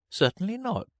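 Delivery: put-on very high, affronted girl; indignant. A narrator's reading. Alice denying she was taught washing, indignantly. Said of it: "said Alice indignantly".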